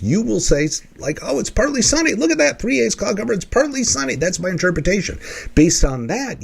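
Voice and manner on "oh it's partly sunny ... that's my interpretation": much higher, bright sing-song delight; playful cheerful voice of a positive person; cheery optimist. Retold or imitated. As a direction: gush like a sunny optimist. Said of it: "If you are positively disposed".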